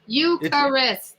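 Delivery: Eucharist said slowly, each syllable clear, YOO-kuh-rist; slow correction. Shown as a demonstration. Correcting the host on the band name, slow and deliberate. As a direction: spell out Eucharist carefully by sound. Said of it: "It's Eucharist".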